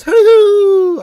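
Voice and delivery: a very high, loud "Hoo-hoo" owl call; owl hoot. Pretend. Reading a viewer's hoot.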